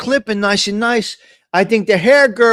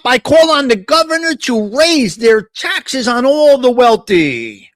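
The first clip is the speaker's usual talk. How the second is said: mocking a ranting senator, higher pitched; Bernie Sanders impression; 3 semitones higher than usual. Host mocking Bernie at his Tax the Rich rally, high mock rant. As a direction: ham up an old politician yelling at a rally. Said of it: "Screaming and yelling".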